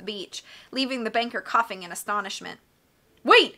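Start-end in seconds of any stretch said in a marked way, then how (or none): 3.2-3.6 high, loud urgent call; ordering. A narrator's reading. Banker to children walking off across the beach, ordering. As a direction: call out to stop them. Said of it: "he ordered".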